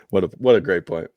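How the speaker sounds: ordinary delivery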